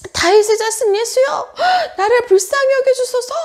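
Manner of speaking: loud, high-pitched desperate plea; acted beggar's full-voiced call; crying out. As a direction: shout for mercy over the crowd. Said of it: "외쳤어요".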